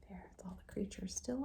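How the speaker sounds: soft whispered aside. Real, not acted. Teacher whispering over the picture book.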